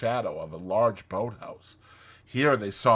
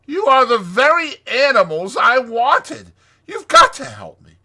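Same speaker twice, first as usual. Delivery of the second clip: high, loud, blustering Toad; 14 dB louder than usual. Acted. Toad urges friends to help him, blustering. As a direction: insist boisterously at full voice.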